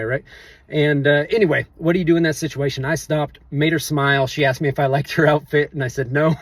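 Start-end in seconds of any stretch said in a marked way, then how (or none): none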